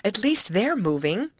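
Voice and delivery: high, excited announcement; thrilled, starting very high and easing down; joyful exclamation. A narrator's reading. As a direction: cry out in delighted surprise. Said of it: "she exclaimed".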